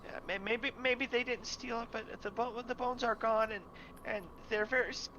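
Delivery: put-on high, stammering child backpedaling; a scared kid taking it back; nervous boy.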